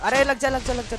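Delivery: high, loud burst of urging; excited urging. Real, not acted. Player willing a shot to land, excited. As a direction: blurt it out fast and excited.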